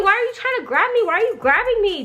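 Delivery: ordinary talk, nothing marked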